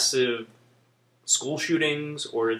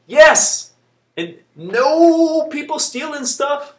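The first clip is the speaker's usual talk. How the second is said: excited cheer; 9 semitones higher, 6 dB louder, slower than usual. Real, not acted. Cheering his wish, playful.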